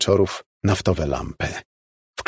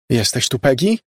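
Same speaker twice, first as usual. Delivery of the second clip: wołanie; 6 semitones higher than usual. A narrator's reading. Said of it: "zawołała".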